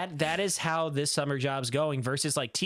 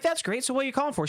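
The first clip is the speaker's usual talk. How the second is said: high, cheery phone small talk; happy chipper; 11 semitones higher, faster than usual. Retold or imitated. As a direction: imitate a breezy, upbeat exchange. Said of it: "happy chipper".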